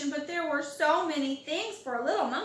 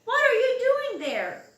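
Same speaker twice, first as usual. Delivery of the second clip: higher, louder startled call; surprised call; 10 semitones higher, 7 dB louder than usual. A narrator's reading. Fire chief spots George with the children, loud surprised call. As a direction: call out across to them, surprised. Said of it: "called the fire tree chief".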